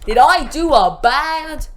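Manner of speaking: hammed-up mock innocence, loud; The Rock. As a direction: draw it out loudly in pretend surprise. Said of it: "he's like".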